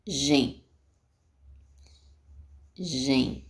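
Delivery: "Gen" repeated as a lone syllable, long pause between; isolated syllable. Shown as a demonstration. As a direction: sound out "Gen" on its own, evenly. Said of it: "Deixa eu fazer de novo".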